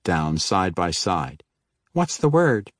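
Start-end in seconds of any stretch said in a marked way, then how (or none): 1.9-2.7 woman's voice. Acted. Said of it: "she asked".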